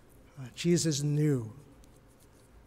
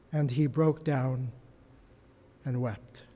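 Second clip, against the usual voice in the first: somber; 4 semitones lower, 4 dB quieter than usual. Acted. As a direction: let the reading sink at Peter's tears. Peter's grief, read softly.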